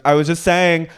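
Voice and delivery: high, loud imitated retort; back-pedal in a put-on high voice; mock defensive. Housewife defending herself in a spat, high mock-defensive voice. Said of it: "And she goes".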